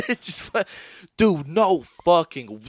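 hammed-up disbelief; mock amazement. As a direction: show the crowd the sight in disbelief.

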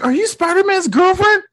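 put-on high voice, asking in disbelief; high-pitched quote. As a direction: squeal it as a nosy onlooker. Acting excited bystander from film.

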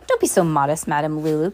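ordinary delivery

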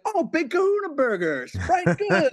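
a playful high take on Jules; imitating Jules. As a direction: chirp it like a breezy snack order. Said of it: "It's like".

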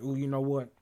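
ordinary delivery

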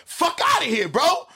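high-pitched indignant protest; indignant outburst. Real, not acted. Protesting he was called late.

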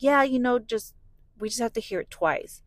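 lightly acted casual reassurance; imitating ultrasound tech. Tech brushing off worried parents.

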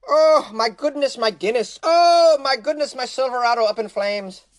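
high, loud, hammy panic; mock panicked owner. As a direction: wail over the burning truck. Playing the truck owner.

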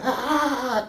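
high mock cry of horror; mock scream. Imitating bystanders screaming at the accident, mock shriek.

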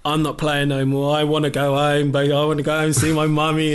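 put-on high, loud whiny child tantrum; spoiled brat. Player mocks himself as a tantruming spoiled kid. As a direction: whine like a spoiled little brat. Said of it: "like a little spoiled little brat".

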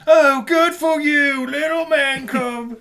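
high, loud, slow hammed-up blubbering; fake-cry through the words, over the top; mock sobbing. Mock sobbing parody of the scene.

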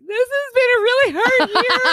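high, teary wail; crying voice. Retold or imitated. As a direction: quote the sob of that moment, voice cracking high. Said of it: "started crying in the car".

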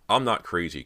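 loud, emphatic denial; insistent. A narrator's reading. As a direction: insist on it forcefully. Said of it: "Cody insisted".

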